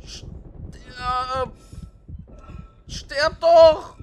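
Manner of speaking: high, loud shout at the enemy; angry shout. Real, not acted. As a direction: scream it at the enemy. Shooting enemies.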